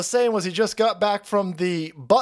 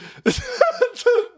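shrieking high laugh; high squeal of laughter. Real, not acted. Streamer cracking up at a clip, high shrieking laugh.

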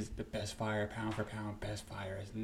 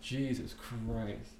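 ordinary talk, nothing marked

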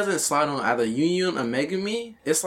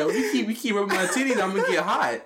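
higher voice, cracking up; laughing mock warning; 4 semitones higher than usual. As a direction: act out the warning through laughter. Retelling a character's warning, laughing.

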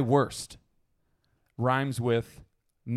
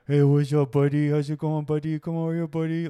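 lightly voiced talking to a dog; a hint of cooing at a puppy; chatting to a dog in a mild pet voice; 3 semitones higher, 3 dB louder than usual. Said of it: "everybody does a dog voice".

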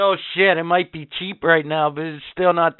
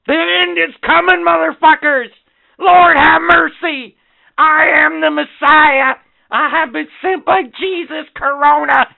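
doomsday street preacher; 11 semitones higher, 7 dB louder than usual. Pretend. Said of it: "Sorry, I digress".

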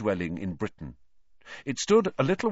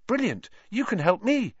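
high, eager schoolboy plea; anxious Neville; 12 semitones higher than usual. A narrator's reading. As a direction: latch on hopefully in a much higher voice. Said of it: "his round face anxious".

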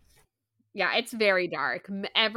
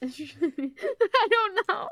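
laughing; 9 semitones higher, 5 dB louder, slower than usual. Real, not acted. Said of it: "I can't even pronounce".

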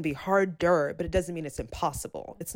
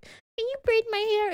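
mock girly request; 13 semitones higher than usual. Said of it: "are like".

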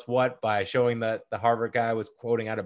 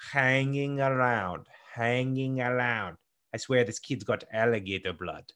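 put-on slow, drawn-out over-the-top Russian accent; weird Russian accent; 4 dB quieter than usual. Movie fan mimicking a card-playing villain, weird Russian accent. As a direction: drag it out in an exaggerated Russian accent. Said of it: "in the weirdest Russian accent ever".

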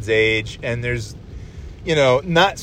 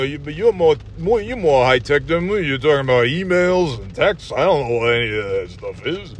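put-on slurred old tough guy, baffled by tech; marble-mouthed, bewildered old-timer impression; Sylvester Stallone. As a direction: mumble through it like an aging action hero. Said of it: "old guys sitting there not knowing".

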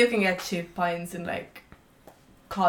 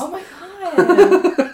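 laughing disbelief; 12 semitones higher, 10 dB louder, much slower than usual. Real, not acted.

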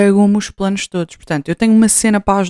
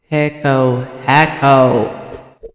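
echoing call, trailing off; canyon echo; 6 semitones lower, much slower than usual. Shown as a demonstration. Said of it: "tava aqui a fazer eco".

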